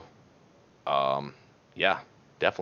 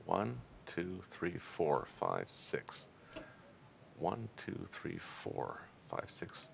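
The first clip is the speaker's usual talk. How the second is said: very soft counting under the breath; counting to oneself; 3 semitones lower, 16 dB quieter, much slower than usual. Real, not acted. Player counting spaces to himself on the map, very softly. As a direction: count it out quietly, just for yourself.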